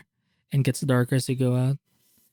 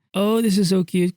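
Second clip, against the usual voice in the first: excited anime fan; 6 semitones higher than usual. Real, not acted. Gushing over cute art.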